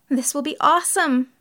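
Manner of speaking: high, loud, thrilled; excited. A narrator's reading. Jerry thrilled by the deal.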